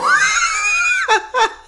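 shrieking laugh, extremely high; high squealing laugh. Retold or imitated. Capping the classmate imitation, squealing laugh. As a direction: let out a long squeal of laughter.

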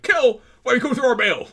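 high-pitched cartoonish scolding; claymation voice. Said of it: "That sounded like a claymation voice".

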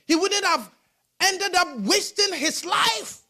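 pounding the pulpit with every word; preaching at full force. Acted.

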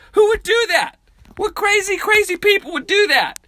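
squeaky high, loud disbelief; incredulous outburst. Real, not acted. Ranting to viewers about drivers on back roads, high squeak. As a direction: shriek it like you can't believe anyone would.

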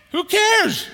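loud, high dismissive cry; sharp exclaimed question. Acted. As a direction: toss the excuse aside. Preacher dismisses excuse.

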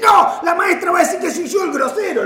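loud, high, laughing protest; alarmed protest. Retold or imitated. Father fearing the teacher will blame him for swearing, mock-indignant shout. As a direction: protest loudly, half laughing.

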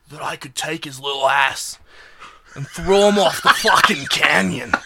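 hammed-up, badly faked Australian accent; terrible Australian accent. Fan of Steve Irwin boasting about a snake, bad Australian accent. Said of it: "That was a terrible Australian accent".

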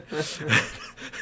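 unvoiced high laugh; short airy burst of laughter; breathy laugh. Real, not acted. Laughing at own story.

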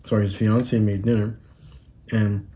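normal talking voice

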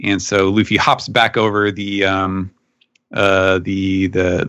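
ordinary talk, nothing marked

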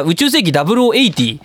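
normal talking voice

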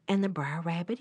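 ordinary delivery

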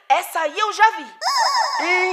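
shouted reveal. Acted. Neighbor reveals sighting, shouting.

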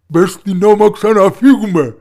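loud, urgent paternal command; father ordering. A narrator's reading. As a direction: order your daughter loudly to get moving. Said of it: "έλεγε ο πατέρας στην κόρη". (επιτακτική, δυνατή φωνή πατέρα)

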